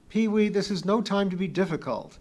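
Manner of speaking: high, loud reprimand; exasperated scolding. A narrator's reading. Kip scolding stubborn Pee-wee, exasperated.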